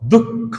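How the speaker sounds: দুঃখ split as "duk... kho"; deliberate দুঃখ, the kh sounded twice; doubled consonant. Shown as a demonstration. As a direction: demonstrate দুঃখ with a held k before "kho". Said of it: "দুবার উচ্চারণ হচ্ছে".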